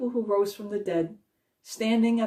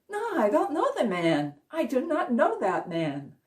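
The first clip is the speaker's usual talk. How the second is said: a higher, emphatic "No, I don't know the man"; insistent Peter denial; 6 semitones higher than usual. Retold or imitated. Peter insists to the accuser that he doesn't know Jesus, voice raised higher. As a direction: push the denial up with rising panic.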